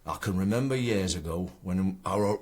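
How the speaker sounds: reciting a poem in Ilkeston accent; Ilkeston dialect. Shown as a demonstration. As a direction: read it aloud in broad Ilkeston dialect. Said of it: "written in the Ilkeston dialect".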